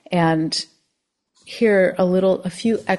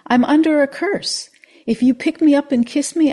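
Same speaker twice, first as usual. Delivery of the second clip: high, louder frog voice; pleading frog; 9 semitones higher, 6 dB louder than usual. Acted. Frog begging the old man to kiss it. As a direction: plead up from the ground.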